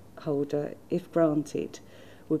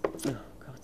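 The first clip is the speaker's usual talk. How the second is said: whispered aside; 4 semitones lower, 10 dB quieter than usual. Real, not acted. Flustered aside while reading.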